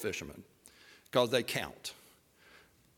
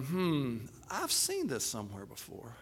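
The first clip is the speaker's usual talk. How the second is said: soft, lower thinking aloud; musing; 4 semitones lower, 8 dB quieter than usual. Acted. [name] sitting there thinking while others fish, musing. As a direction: muse quietly, lost in deep thoughts. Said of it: "kinda like Deep Thoughts with Jack Handey".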